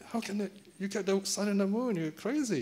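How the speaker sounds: high-pitched, incredulous protest. Acted. Father answers his son's impossible wish, incredulous. Father disbelieves the boy's absurd request.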